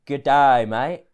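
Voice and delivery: good DAY with a loud, stretched Aussie vowel; accentuated Australian accent. Shown as a demonstration. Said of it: "Like, accentuate the ah".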